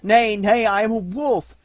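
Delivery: high, loud protest; boy crying out to the dying wolf. A narrator's reading. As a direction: cry out against being called a man. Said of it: "Mowgli cried".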